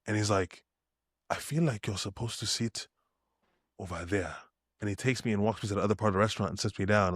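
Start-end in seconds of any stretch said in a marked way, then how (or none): 1.3-4.3 much softer, trancelike murmur; dreamy soft voice. Retold or imitated. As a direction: say it as if half listening to something far away. Zoned-out stranger quoted.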